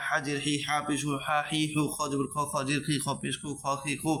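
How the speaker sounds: quick, run-together spelling-out of Arabic syllables; speed recitation. Shown as a demonstration. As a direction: rattle the letter drill off without pauses. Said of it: "এরকমভাবে স্পিডভাবে আমি বলতে পারি". (না থেমে দ্রুত একটানা পড়া)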